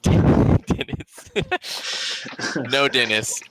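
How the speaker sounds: laughing through the name; mock mournful, laughing. Real, not acted. Laughing at forgotten NPC.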